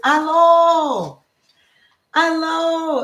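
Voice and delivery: Hallo sung out high and loud, falling; demo German Hallo. Shown as a demonstration. Host teaching viewers the German greeting, high and bright. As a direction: present Hallo like a bright lesson word. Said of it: "It is how you say hello in German".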